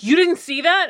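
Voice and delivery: surprised. Retold or imitated. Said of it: "they're like".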